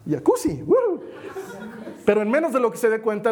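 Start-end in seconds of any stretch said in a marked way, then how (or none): 0.1-1.0 a preacher hamming up a frog enjoying the jacuzzi; surprised frog. Pretend. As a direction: frog in warming water, playful mock delight. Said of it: "y el sapo dice".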